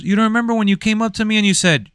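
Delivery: high, fast goofy thug; squeaky thief. Retold or imitated. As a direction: rattle it off way up high. Thief recalling.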